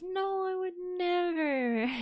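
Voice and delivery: high, slow hammed-up denial with a whine; mock protest at chat's accusation; playful fake-hurt whine answering chat; mock-offended. Real, not acted.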